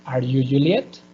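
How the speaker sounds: "Are you Juliet?" climbing steeply on "Juliet"; yes-no question, upward glide on "Juliet"; rising intonation. Shown as a demonstration. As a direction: lift the end of "Juliet" into a question.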